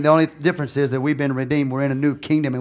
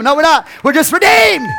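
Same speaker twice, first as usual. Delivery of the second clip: rising into a loud, high preaching cry; preaching shout; 11 semitones higher, 6 dB louder than usual. Real, not acted. Preacher to the congregation, shouting in full fervour.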